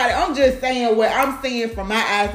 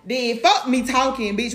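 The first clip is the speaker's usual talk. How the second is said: usual speaking voice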